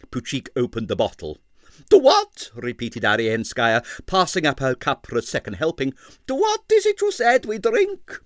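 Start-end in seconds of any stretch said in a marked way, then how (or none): 1.9-2.5 very high, loud question; toasting diva. Acted. Arienskaya repeats toast. 6.3-8.2 very high diva voice; curious diva. Acted. Arienskaya repeats toast.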